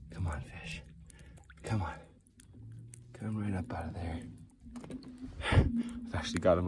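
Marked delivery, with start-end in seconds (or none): none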